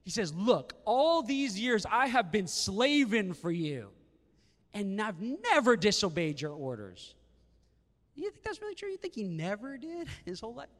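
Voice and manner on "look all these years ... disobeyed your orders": louder, slow resentful complaint to his father; bitter older son pleading his case, louder; angry son. Acted. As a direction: dig in with a grudge, slowing down. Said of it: "he's angry".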